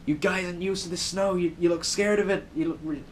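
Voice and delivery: hammy attempt at an American accent; bad imitated local accent. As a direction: put on a terrible New Yorker accent. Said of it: "sorry if the accent's terrible".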